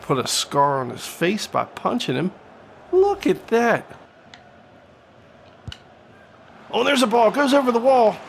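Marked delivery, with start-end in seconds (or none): 2.9-8.2 excited call. Real, not acted. Free kick, commentator calls it.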